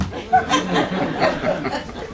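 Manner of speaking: high airy laugh at his own joke; breathy laugh. Real, not acted. Laughing at his own punchline to the crowd.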